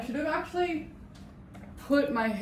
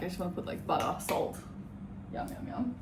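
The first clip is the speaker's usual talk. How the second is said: whispered craving; 9 dB quieter than usual. Real, not acted. Craving potatoes, whispering yum.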